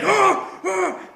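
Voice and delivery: put-on panicked kids gasping; hammed-up shocked children; kids' shocked gasp. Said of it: "they're all like".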